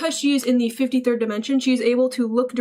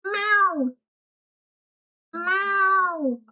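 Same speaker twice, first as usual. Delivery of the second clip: high, drawn-out meow; cat meow; 7 semitones higher than usual. Pretend. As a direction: imitate a cat meowing. Imitates a cat.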